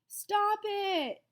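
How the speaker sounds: high, loud plea; boy crying out at the teasing kids, loud. A narrator's reading. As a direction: yell to make them stop. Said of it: "cried Ashley".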